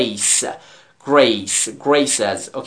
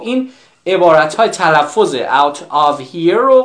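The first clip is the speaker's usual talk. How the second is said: ordinary talk, nothing marked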